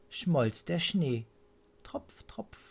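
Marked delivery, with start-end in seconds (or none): 1.8-2.6 whispered drip, drip; soft as melting drops; dripping sound. A narrator's reading. Snow melting from the cherry tree, whispered drips.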